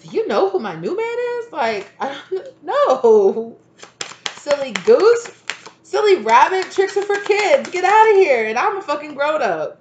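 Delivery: high, loud, mocking dismissal; sassy loud brush-off. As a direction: shout them off with sass. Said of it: "And you're like".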